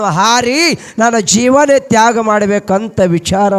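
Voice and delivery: full-voiced devotional storytelling; forceful preaching. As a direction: preach it out to the crowd. Preacher tells Siddharama story forcefully.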